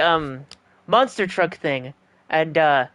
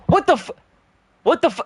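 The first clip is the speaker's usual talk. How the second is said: high, cut-off gasp of disbelief; shocked outburst; 9 semitones higher, faster than usual. Real, not acted. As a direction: blurt it out in shock. Shocked at the second-place result.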